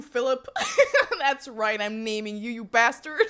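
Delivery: normal talking voice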